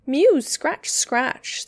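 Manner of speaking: high loud mew, then a breathy scratch; a cat scuffling at the window. A narrator's reading. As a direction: mew sharply and scratch at the sill. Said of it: "scuffled Simpkin".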